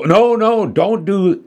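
high, urgent cut-off cry; alarmed warning. A narrator's reading. Dad stopping daughter with the fuel starter, alarmed. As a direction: pitch it way up to stop them. Dad tries to stop danger.

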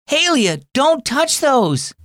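high, slow desperate call to stop her; drawn-out frantic warning; panicked cry. Acted. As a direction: cry out in alarm to your little sister. Watches sister about to wreck dominoes.

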